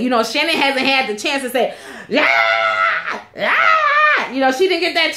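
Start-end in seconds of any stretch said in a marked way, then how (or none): 2.1-4.3 mock scream.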